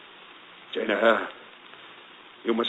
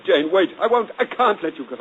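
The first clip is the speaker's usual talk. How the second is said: desperate; 9 semitones higher, 7 dB louder than usual. Acted. Rochester pleads desperately with Jane.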